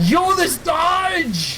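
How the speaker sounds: slow, high excited yell; hype shout. Real, not acted. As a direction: yell it out hyped at the play. Hype shout at game play.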